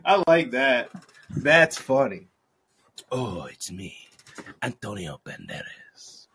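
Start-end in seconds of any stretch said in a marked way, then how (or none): 3.1-6.3 low, hushed mock Spanish accent; Antonio Banderas. Introducing himself as a Spanish actor, hushed Spanish accent. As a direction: purr it softly in a Spanish accent. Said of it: "It's like Antonio Banderas is Spanish".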